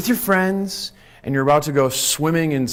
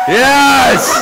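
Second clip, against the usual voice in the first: high, loud yes; excited cheer; 8 semitones higher, 9 dB louder than usual. Real, not acted. Host cheering a volunteer playing an elephant, loud excited cheer. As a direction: cheer him on.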